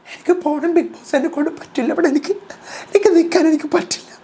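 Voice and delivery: very high, laughing mock wail; hammed-up whiny Jasmine. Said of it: "Jasmine പറയാണ്".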